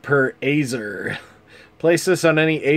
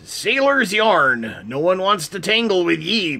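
put-on pitched-up pirate accent for the card text; 4 semitones higher than usual. Reading the pirate set's cards to viewers in a pitched-up pirate accent. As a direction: growl it out like a cheery pirate, higher. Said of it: "I'm gonna do a pirate accent for all of this".

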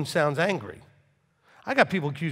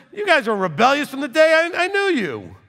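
high, loud scolding; stern rebuke; 11 semitones higher, 8 dB louder than usual. Acted. Rebuking the people Moses led, high and loud. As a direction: shout it accusingly at a stubborn people. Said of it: "Then he said about the people that Moses dealing with".

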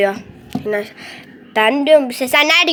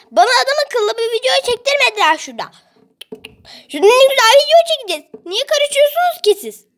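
fast, high, tearful rant; crying tantrum; 6 semitones higher than usual. Real, not acted. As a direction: rant through tears at the family. Upset at noisy family. (aileye ağlamaklı, hızlı, sinirli serzeniş)

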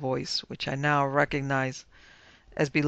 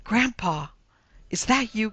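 high, gasped question; astonished lady, pitched up; startled gasp; 7 semitones higher, 4 dB louder than usual. A narrator's reading. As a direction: ask it in astonished hope. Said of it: "gasped the fat lady".

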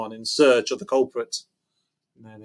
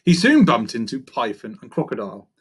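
plain reading voice

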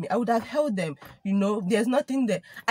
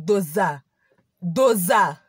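"Doza" struck louder on the repeat; slap sound word; 2 semitones lower, 4 dB louder, slower than usual. Retold or imitated. Showing the listener how the slap sounded. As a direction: land each "Doza" like a blow.

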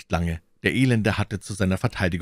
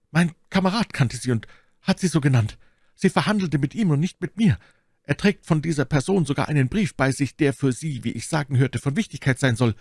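anxious high-pitched explaining; cowardly captive in a raised voice; frightened bandit; 7 semitones higher than usual. Acted. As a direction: explain yourself eagerly to your captor.

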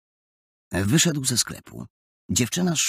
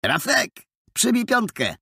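put-on high-pitched boyish hail; high, lively call to a pal; calling to a mate; 12 semitones higher than usual. A narrator's reading. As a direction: hail a buddy in a raised voice. Boy hails his mate in story.

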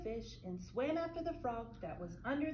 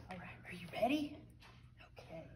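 whisper; 3 semitones lower, 3 dB quieter, much faster than usual. Real, not acted. Whispering to the children.

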